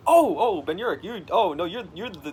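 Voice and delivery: high, loud fanboy gush; starstruck. Retold or imitated. Witness meeting Ben Urich, loud and starstruck. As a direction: swoon over meeting a hero. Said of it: "It's like".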